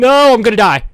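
high, loud real panic; panicked yell. Real, not acted. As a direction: shout it as you're about to die. Nearly killed in a cave.

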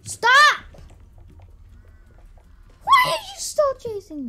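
high loud protest; high panicked yell. Real, not acted. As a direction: shout it while fleeing. Being chased.

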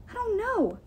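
put-on high, worried young voice; worried girl. A narrator's reading. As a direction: lift it up anxiously. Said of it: "Sam said".

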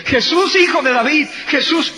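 high, loud calling out, rising; shouting after him. Acted. Mother chasing Jesus and disciples, high loud shouting. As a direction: call after him as he walks away.

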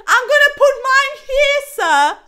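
high, loud defiant yell; heated challenge. Real, not acted. Chess player defying the opponent with a move, angry yell.

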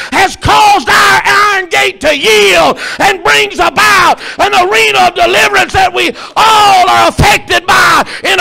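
high, loud shouted sermon; full-force preaching. Acted. Preacher telling the church the iron gate will yield, shouting at full force. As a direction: shout it out in driving, punched phrases.

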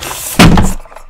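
forceful voiceless breath out; exasperated huff. Real, not acted. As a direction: blow out hard in frustration. Exasperated after wasting a sub.

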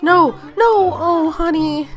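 high, loud cry of dismay; dismayed outcry. Real, not acted. Child has an accident.

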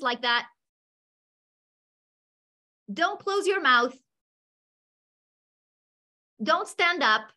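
high, brisk classroom commands; a teacher calling out negative instructions; teacher giving commands. Shown as a demonstration. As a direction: bark orders at the class. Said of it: "I'm going to read these phrases and please do as I say".

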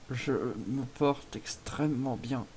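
very slow, halting claim of wellness; exaggeratedly slow delivery; drawn-out reassurance. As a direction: stretch the words out haltingly. Feigns being fine in bonus clip.

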